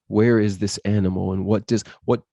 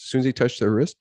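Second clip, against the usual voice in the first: normal talking voice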